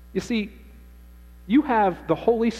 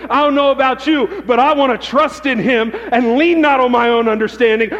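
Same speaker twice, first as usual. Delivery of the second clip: loud, high, building sermon cadence; fervent pulpit preaching; preaching at full force; 6 semitones higher, 6 dB louder than usual. Acted. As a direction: preach it out, climbing.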